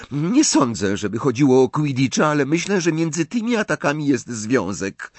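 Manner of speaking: plain reading voice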